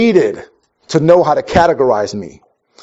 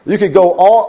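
ordinary talk, nothing marked

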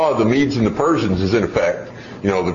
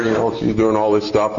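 normal talking voice

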